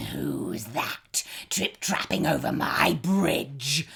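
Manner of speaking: troll challenging the goat on his bridge, booming. A narrator's reading. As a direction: boom it out like a mean troll. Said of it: "he boomed in his meanest voice".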